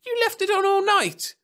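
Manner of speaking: very high, loud screech of disbelief. A narrator's reading. Wife appalled at husband's blunder, screeching. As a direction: screech it at the top of the voice. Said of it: "screeched Laurie".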